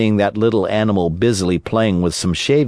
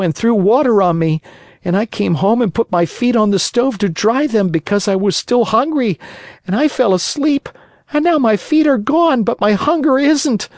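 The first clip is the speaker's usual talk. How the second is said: high-pitched little puppet voice, pouring out woes; hungry puppet boy; 12 semitones higher than usual. Acted. Pinocchio tells Geppetto his misadventures, high plaintive puppet voice. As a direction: tell it high, childlike and plaintive.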